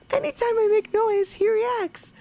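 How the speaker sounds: very high cartoon princess voice; squeaky sweet princess reading; Princess Peach voice. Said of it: "Let me read it like, uh, Princess Peach's note".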